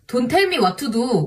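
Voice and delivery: "don't tell me what to do" with dental t sounds in an Indian accent; "tell" stressed with a falling tune, Indian accent. Shown as a demonstration. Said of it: "Pronouncing English words in Indian and Korean accent".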